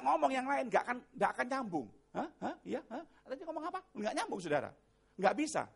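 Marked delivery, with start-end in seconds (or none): 2.2-3.8 rising, puzzled huhs; acted absent-minded asking, soft and high; distracted, confused husband. Man busy with a task misses what was said, confused mock questioning.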